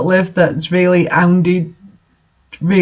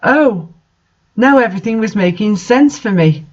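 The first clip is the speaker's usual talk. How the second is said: ordinary talk, nothing marked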